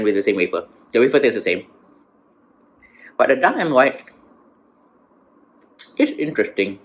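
chewing while talking; speaking between bites; mouth full of chocolate. Real, not acted. Seen 1: bites bar at 0.1–1.1, then chews, speaks with food.

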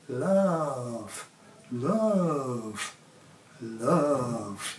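"Love." low, soft and drawn out; low tender love. Shown as a demonstration. Showing fellas how to say love, low and soft. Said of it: "You can do it all sorts of ways".

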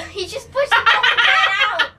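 high, loud words through laughter; laughing shout. Real, not acted. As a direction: shriek it while cracking up. Laughing hard at the game.

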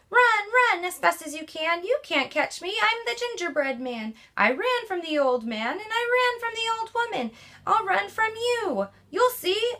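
high, boastful gingerbread man taunting; high cheeky runaway cookie; cocky gingerbread man. Acted. Gingerbread man voiced in story.

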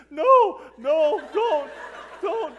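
high, loud mock-sobbed protest; weeping plea. Tearful youth leader pleading as parents wait, weeping. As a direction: imitate blubbering while begging them to stay. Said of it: "I'm, like, openly weeping".

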